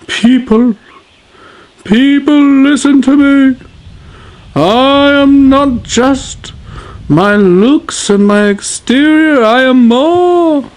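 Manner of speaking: orate like a solemn king; strange king voice. Acted. King addressing his subjects in a strange voice. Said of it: "Why is he using that strange voice".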